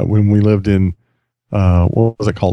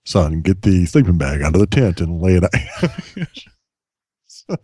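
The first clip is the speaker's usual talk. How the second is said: lower, slow, a hint of a stately announcer; lightly imitating a voiceover dad; 4 semitones lower, much slower than usual. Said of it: "that's just the way he talks".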